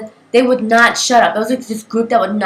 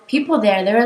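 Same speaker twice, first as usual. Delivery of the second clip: usual speaking voice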